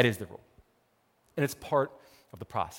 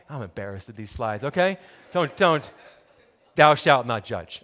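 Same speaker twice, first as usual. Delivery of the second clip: usual speaking voice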